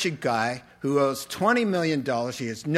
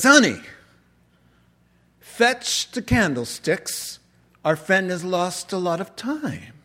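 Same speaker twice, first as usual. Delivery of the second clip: kindly bishop voice; 5 semitones higher than usual. Retold or imitated. Bishop voiced in retold story.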